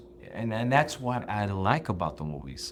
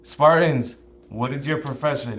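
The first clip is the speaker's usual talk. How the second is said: loud, raised commanding call; commanding shout; 6 semitones higher, 7 dB louder than usual. Retold or imitated. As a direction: bellow it like a king to troops. Acting out Leonidas's shout.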